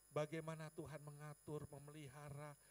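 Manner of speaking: ordinary talk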